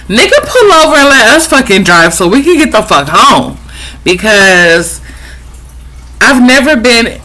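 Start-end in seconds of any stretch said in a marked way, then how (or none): 0.1-3.6 fast, higher exasperated plea; acted impatient outburst. Acted impatient plea to slow driver.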